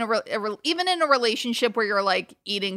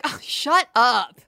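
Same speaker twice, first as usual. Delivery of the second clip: dismissive; 3 semitones higher, 4 dB louder, slower than usual. Retold or imitated. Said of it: "a boyfriend talk to his girlfriend and being like".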